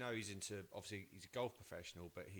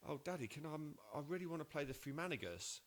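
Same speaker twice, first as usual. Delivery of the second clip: put-on child voice; 6 semitones higher, faster than usual. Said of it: "she goes".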